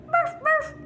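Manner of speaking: muffled little dog woofs; imitated dog bark. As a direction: imitate the second dog barking. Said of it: "Rex and Zippo would fill my head".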